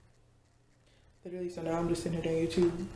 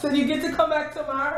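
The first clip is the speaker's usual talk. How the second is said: high, louder singsong teasing; playful high voice; 10 semitones higher, 5 dB louder than usual. Acted. Teasing coworker leaving work, high singsong. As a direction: tease them in a squeaky singsong.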